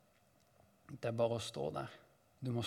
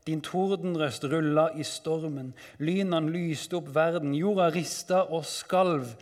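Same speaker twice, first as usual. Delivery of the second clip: raised, driving storm narration; dramatic psalm declamation; 3 semitones higher, 5 dB louder than usual. Acted. Psalmist recalling God's power over the waters, forceful declamation. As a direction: proclaim it with mounting force.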